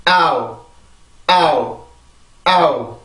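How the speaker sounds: 아우 in one glide, stress on 아, falling; one-syllable diphthong. Shown as a demonstration. Teacher demonstrating owl for students, one stressed falling syllable. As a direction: hit 아 once and slide down into 우. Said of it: "성대가 한 번만 긴장을 해주면 돼요".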